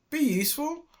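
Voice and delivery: high rising proud be useful; triumphant. A narrator's reading. Dilly proves her worth to robbers, triumphant rising. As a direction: throw it back as proof.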